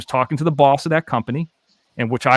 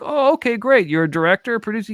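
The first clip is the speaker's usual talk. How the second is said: mock enthusiasm; 9 semitones higher than usual. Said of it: "I'm like".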